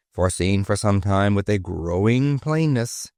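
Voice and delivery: ordinary delivery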